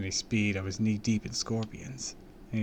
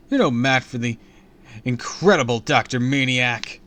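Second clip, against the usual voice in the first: louder boasting jeer; loud taunt; 4 semitones higher, 10 dB louder than usual. A narrator's reading. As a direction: jeer at the villain. Hero keeps taunting.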